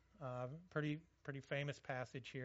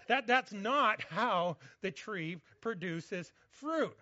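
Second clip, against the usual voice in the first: emphatic correction; 4 semitones higher, 10 dB louder, slower than usual. Real, not acted.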